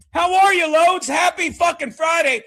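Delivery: high, loud on-air welcome shout; loud hearty greeting. Real, not acted. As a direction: belt out the welcome to the cohost. Radio host greeting cohost.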